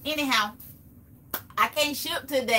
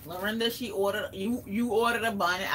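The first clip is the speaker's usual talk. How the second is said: ordinary delivery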